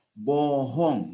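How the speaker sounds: slowly stretched "Bohong"; slow, dragged-out reading of the word; drawn-out word. Retold or imitated. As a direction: draw out both syllables of "Bohong". Drawn-out word read off sticker.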